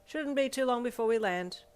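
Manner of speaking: high, loud captain's announcement; captain over loudspeaker. A narrator's reading. Captain updates passengers before landing, announcing loudly. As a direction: project it like a pilot to passengers. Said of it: "said over the plane's loudspeaker".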